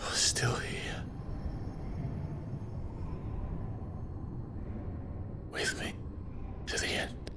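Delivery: very soft, halting whisper; weak whisper. Acted. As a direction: whisper it faintly, with long pauses. Weakened man speaks to companion.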